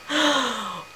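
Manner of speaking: sharp high playful gasp; mock gasp. Vlogger reacting to her silly pub name with a playful gasp. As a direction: gasp as if scandalised by your own joke.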